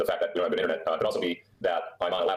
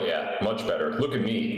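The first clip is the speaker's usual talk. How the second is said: ordinary delivery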